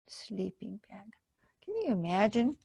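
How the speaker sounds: hushed amazed whisper. Acted. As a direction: whisper it to the kids. Hushed aside to children.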